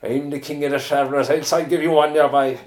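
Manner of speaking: put-on over-the-top Irish traveller accent, high and loud. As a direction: ham up a Cork traveller threat. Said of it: "the most ridiculous traveller accent you ever heard".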